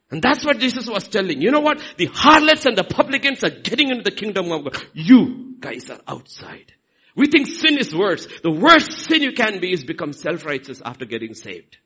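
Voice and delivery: ordinary talk, nothing marked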